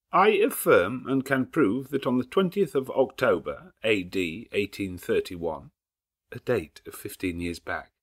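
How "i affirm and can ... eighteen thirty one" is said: louder, formal reading tone; official nasal voice. A narrator's reading. Lawyer reads the marriage record, official nasal voice. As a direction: read it like a legal record. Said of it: "read out in a sort of official nasal voice".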